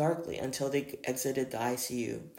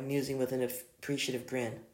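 ordinary delivery, nothing marked

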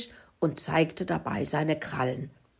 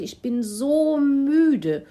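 high, drawn-out tired complaint; plaintive excuse, starting loud and dropping away; ashamed pig; 7 semitones higher, 4 dB louder, slower than usual. Acted. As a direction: stretch it out like a worn-out, sheepish pig. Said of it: "schaute beschämt auf den Boden und sagte".

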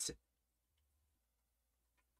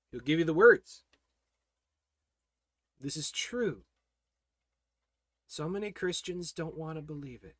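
usual speaking voice

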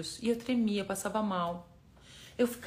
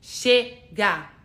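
chega shouted out loud as a war cry; battle cry shout; 8 dB louder than usual. Shown as a demonstration. Coach showing viewers the battle cry, loudly. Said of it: "Aquela assim".

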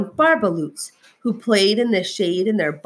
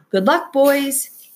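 calling out a hopeful goodbye; cried out; 7 semitones higher, 3 dB louder, slower than usual. A narrator's reading. Lorax calling goodbye to his barbaloots, slow. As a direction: cry it after the departing barbaloots. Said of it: "he cried".

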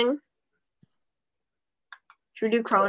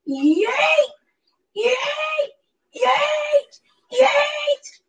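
shouted yeet; much slower than usual. Real, not acted. Shouted high battle cry.

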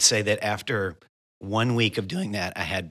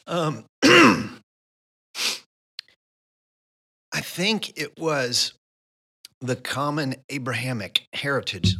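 strained, choked-up voice after coughing; talking through a throat full of coffee; choking on coffee; 5 semitones higher, 4 dB louder than usual. Real, not acted. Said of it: "Sounds like I'm crying".

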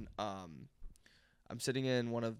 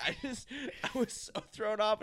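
ordinary talk

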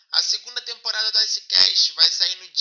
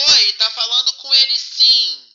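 ordinary delivery

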